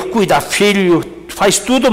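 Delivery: ordinary talk, nothing marked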